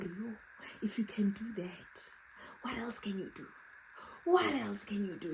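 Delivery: a scared whisper behind her back; fearful whisper. Retold or imitated. Scared of the fearless lady.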